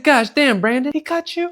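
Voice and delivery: high, loud scandalized cry; shocked outburst. Retold or imitated. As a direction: gasp it out in disbelief. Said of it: "I said".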